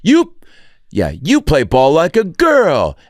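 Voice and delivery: high, slow, jeering taunt; mocking kid taunt. Ham Porter taunting the other team, jeering high voice. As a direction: sneer it like a schoolyard insult.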